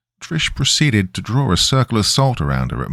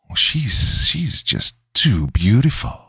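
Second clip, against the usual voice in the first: high, creepy demon gushing; creepy raised-pitch admiration; leering demon; 4 semitones higher, slower than usual. A narrator's reading. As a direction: linger lecherously over the words. Demon leers at Trish.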